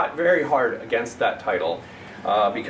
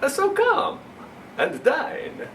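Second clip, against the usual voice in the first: slow, louder, sinister vampire reading; Dracula poem voice; 5 semitones higher, 5 dB louder, much slower than usual. Acted. Poet reads Dracula's advice to children, slow spooky vampire voice. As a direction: savour every gruesome line like a count inviting you to dinner.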